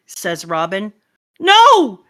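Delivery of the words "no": high, loud drawn-out "no"; anguished cry. Acted. Villager watching the library burn, anguished cry. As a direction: wail it in protest.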